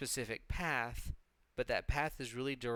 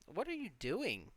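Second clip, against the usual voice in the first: normal talking voice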